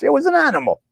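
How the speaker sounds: high mock. Mocking a caller's words in a high put-on voice. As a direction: toss it up high like a jeer. Mock correction, repeated plainly after.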